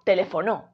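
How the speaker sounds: "teléfono" stressed on final NO; stress on last syllable. Shown as a demonstration. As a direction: land on NO, te-le-fo-NÓ. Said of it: "Y si fuese no".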